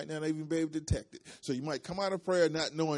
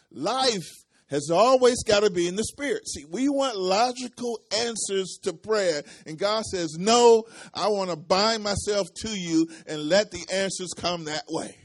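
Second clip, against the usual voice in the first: loud, raised preacher's voice; full-force preaching; 5 semitones higher, 11 dB louder than usual. Real, not acted. Preacher tells the congregation how God answers prayer, preaching at full force. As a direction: drive it home to the congregation at full volume.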